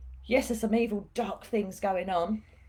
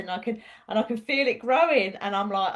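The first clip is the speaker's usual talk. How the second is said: ordinary talk, nothing marked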